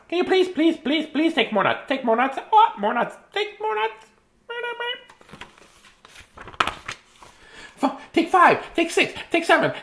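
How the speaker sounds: high excited squirrel, pushing nuts; squeaky eager squirrel offering plate after plate. Acted. Reading the book aloud as a high eager squirrel.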